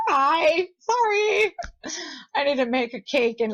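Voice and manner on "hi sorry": high, loud hi, sorry; retold embarrassed squeal; squealed apology. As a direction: yelp it out while backing away. Fleeing the scene apologizing.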